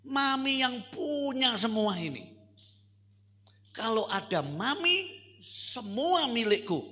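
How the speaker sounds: put-on high, loud insistent little girl; insistent child. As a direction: declare it like a stubborn child. Child explains loudly. (anak kecil bersikeras dengan suara tinggi)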